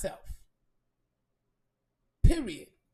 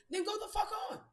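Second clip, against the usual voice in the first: defiant shout; 9 semitones higher, faster than usual. Real, not acted. Telling the friend to go away, in a high angry shout. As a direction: shout her off, fed up.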